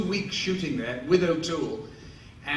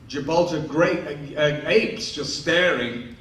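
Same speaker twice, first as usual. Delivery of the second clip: ordinary talk, nothing marked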